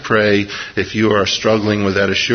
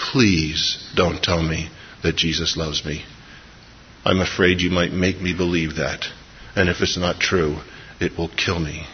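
quiet plea; 4 semitones lower, 6 dB quieter than usual. Retold or imitated. Suicidal teen begs Marge, hushed and afraid. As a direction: lower and softer, a frightened plea. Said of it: "She said".

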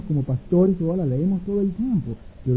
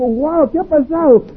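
much higher, louder, slow amazed question; surprised wife; 12 semitones higher, 8 dB louder, slower than usual. Acted. Wife seeing her husband leap up, asking in slow high surprise. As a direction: gasp it out in astonishment. Wife sees him jump.

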